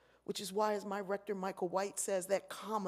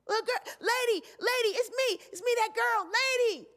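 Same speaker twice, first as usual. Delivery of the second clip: put-on much higher and louder, an eager small child; little girl calling; 14 semitones higher, 8 dB louder than usual. Little girl calls the lady across the plane, high and eager. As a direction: call out like a kid running down the aisle.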